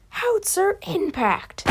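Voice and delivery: imitated anime attack yell; mock battle yell. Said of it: "and then he yells".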